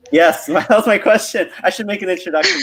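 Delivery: high-pitched reply breaking into laughter; amused, laughing. Real, not acted. Joking back to a colleague who cut in, laughing. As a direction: answer through real laughter, pitched well up.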